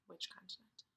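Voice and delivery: breathy teacher whisper. Shown as a demonstration. Teacher whispering a question to the kids.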